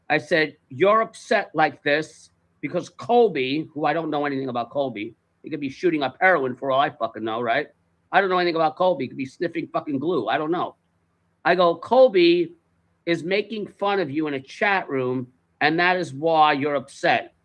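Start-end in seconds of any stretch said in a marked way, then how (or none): 0.7-3.7 slow, measured incredulous recap; retold careful deliberation. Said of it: "Let me understand this very carefully". 11.8-17.3 slow, spaced-out recap; retold careful deliberation. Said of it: "Let me understand this very carefully".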